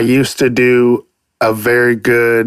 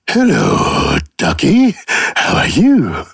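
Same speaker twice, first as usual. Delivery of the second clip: cartoon character voice. Playing voices, greeting a duck.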